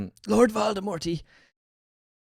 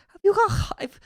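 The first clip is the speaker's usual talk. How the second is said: much higher mangled Scouse jab; mock Scouse. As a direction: tease a naughty chatter in Scouse. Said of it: "we'll say".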